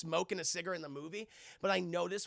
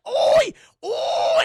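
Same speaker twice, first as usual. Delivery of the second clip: very high, loud shrieking oi; mock Cockney shout; 17 dB louder than usual. Teacher catching the girls smoking, shrieking Cockney oi.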